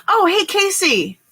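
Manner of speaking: very high, bright squeal of greeting; light up at a friend's name; high excited hello; cheery hello. Real, not acted.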